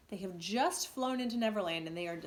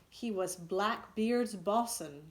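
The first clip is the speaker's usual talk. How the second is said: ordinary delivery, nothing marked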